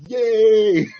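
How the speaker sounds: high, loud drawn-out cheer; retold shout. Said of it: "I just shout".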